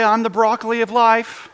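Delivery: laughing through the silly misquote; laughing mock quote. Pastor joking to the congregation about Jesus, laughing. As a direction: tease it with a laugh.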